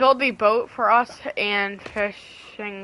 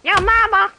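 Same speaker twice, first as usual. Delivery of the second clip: taunting shout; 12 semitones higher, 8 dB louder than usual. Acted.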